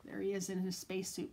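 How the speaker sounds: usual speaking voice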